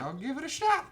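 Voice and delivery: very high, squeaky cartoon reply; hammed-up high-pitched acceptance; mock heroine voice.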